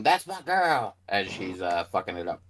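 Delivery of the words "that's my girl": a mild, pretend scream of pride; mock scream. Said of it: "I scream".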